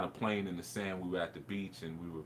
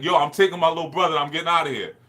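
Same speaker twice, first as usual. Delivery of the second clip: loud, raised, fast mock defiance; defiant shout; 8 semitones higher, 13 dB louder, faster than usual. Boy taking his little brother from a cop, defiant shout. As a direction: announce it boldly, like a fired-up kid.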